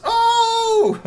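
very high loud squeal; delighted squeal. Acted. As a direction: squeal as you jump. Girl leaps into arms.

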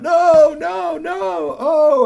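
very high, very loud wail; despair. Acted. Vendors over the engine, very loud high wail. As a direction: cry out at the disaster.

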